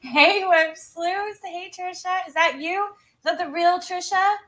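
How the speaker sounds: high, bright-eyed hello to viewers; excited greeting. Real, not acted. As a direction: greet them with a big lifted voice. Greeting chat viewers by name.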